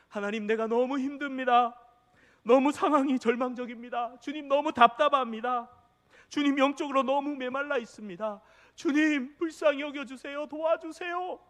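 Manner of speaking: high, loud, pleading cry to God; desperate prayer. Acted. Preacher acting out a desperate prayer, loud and high pleading. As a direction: beg God for help with rising despair. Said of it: "저도 그럴 때가 있습니다".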